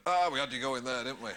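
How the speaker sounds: bloke teasing. Retold or imitated. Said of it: "and he went".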